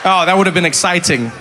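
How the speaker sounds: ordinary talk, nothing marked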